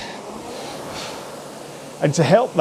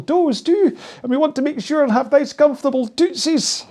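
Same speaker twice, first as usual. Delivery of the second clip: lightly put-on high Scottish accent, much higher; a hint of a playful Scottish lilt, pitched up high; Mrs Doubtfire Scottish; 11 semitones higher than usual. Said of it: "suddenly gone very Scottish".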